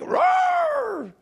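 very high, drawn-out roar; imitated roar, held long; mock roar. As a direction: let out a theatrical roar as the enraged father. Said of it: "He's like".

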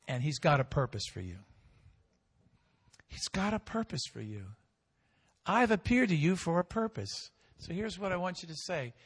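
ordinary talk

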